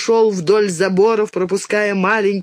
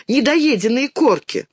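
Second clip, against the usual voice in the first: put-on higher-pitched indignant girl; childish indignation, raised pitch; 7 semitones higher than usual. A narrator's reading. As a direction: protest it as an outraged question. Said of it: "возмутилась Динка".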